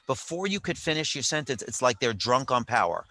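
usual speaking voice

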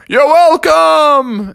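high, loud, drawn-out call; departing dragon. Acted. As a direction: sing it out while flying away. Dragon calls while flying away.

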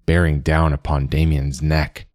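usual speaking voice

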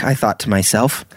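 ordinary delivery

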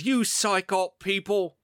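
lightly acted angry accusation; imitated anger. Paranormal expert mad at the skeptic guy, imitated anger. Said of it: "Pascoe is mad at the skeptic guy".

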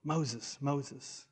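a quiet divine call; hushed voice of God. Acted. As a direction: call his name gently from the bush. God calling Moses again.

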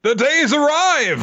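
high, slow, grand announcer boom; epic announcer. Pretend. Cohost hyping the episode, in an epic announcer voice. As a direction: proclaim it like an anime trailer.

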